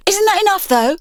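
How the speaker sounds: high, indignant comeback; retort. Acted. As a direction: fire it back. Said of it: "she retorted".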